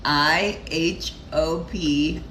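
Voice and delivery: ordinary talk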